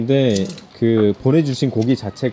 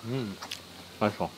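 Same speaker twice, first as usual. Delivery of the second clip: chewing while talking; 4 semitones lower, 14 dB quieter than usual. Real, not acted. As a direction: mouth full of food. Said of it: "씹고 말해야지".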